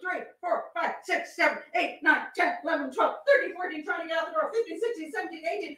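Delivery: rapid, high-pitched rattled-off count; urgent re-enactment count; frantic counting. Acted. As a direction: count in a breathless rush, as if racing the clock. Acting out hundred rapid stabs against clock.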